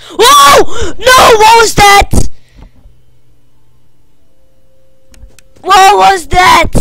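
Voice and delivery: high, loud frightened screaming; terrified scream. Real, not acted. Streamer hit by a jump scare, screaming.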